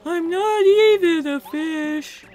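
high, long-vowelled whale. Acted. Whale begs Mario to check its belly, whale voice. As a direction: beg in wailing whale song. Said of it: "give me your best whale voice".

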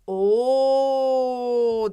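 one long, sustained "Oo"; long drawn-out O. Shown as a demonstration. Teacher introducing the O sound to first graders, long drawn-out O. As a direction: hold "Oo" out loud and steady. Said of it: "dediğinizi duyar gibiyim".

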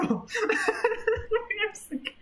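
high laugh breaking the words; laughing off the fright; nervous laugh. Real, not acted. Player after a scare, nervous high laugh.

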